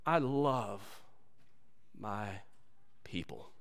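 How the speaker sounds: slow, soft and drawn out, dropping very low; tender. Acted. Preacher voicing God tenderly to his people. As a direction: lay each word down gently as God's love. God's tender declaration, slowed.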